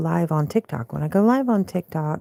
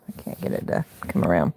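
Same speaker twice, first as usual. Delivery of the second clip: ordinary talk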